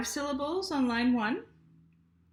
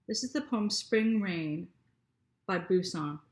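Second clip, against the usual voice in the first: ordinary delivery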